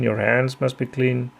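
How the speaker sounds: usual speaking voice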